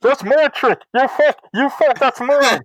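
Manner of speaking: high, loud, slow outraged squawk; indignant villain. Retold or imitated. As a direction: protest your stolen trick like a petty showman. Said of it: "Mysterio turns around and like".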